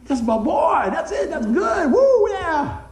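acted inner shout of a proud parent; excited proud cheer. Dad cheering inside for his boy at soccer, excited whooping. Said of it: "But that's how I feel inside".

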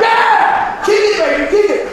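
high, loud hype shout; excited egging-on. Retold or imitated. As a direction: cheer him on, re-enacting the thrill. Cheers on the testifying man.

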